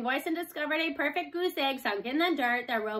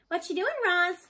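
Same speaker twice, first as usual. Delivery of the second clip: calling out; 6 semitones higher, 5 dB louder than usual. A narrator's reading. Boy calling out to robot.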